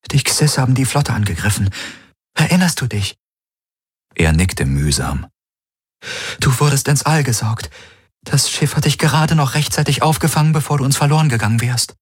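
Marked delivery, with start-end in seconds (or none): 0.1-3.2 higher-pitched, gentle female character; concerned woman companion. A narrator's reading. Kirai fills in her just-woken friend, gently concerned. 6.4-12.0 higher-pitched, gentle female character; concerned woman companion. A narrator's reading. Kirai fills in her just-woken friend, gently concerned.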